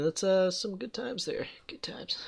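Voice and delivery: whispered nostalgia; whispering. Real, not acted. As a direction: whisper it fondly. Fond whisper.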